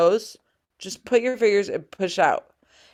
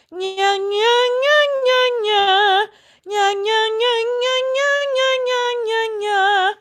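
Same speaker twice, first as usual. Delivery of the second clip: high sung nasal nya; nasal twang; 14 semitones higher than usual. Shown as a demonstration. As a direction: sing it through the nose. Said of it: "I would practice this in this way".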